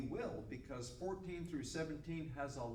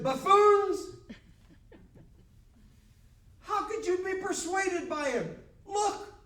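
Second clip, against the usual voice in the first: high, very loud mock-priestly tirade; outraged scolding; 12 semitones higher, 11 dB louder than usual. Chief priests berate officers persuaded by Jesus, outraged. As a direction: berate them at a shout, full of disbelief.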